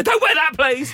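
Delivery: high, loud, sharp telling-off; mock strict dad. Dad warning his teenage daughter about her outfit, mock strict. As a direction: bark it like a dad who has had enough.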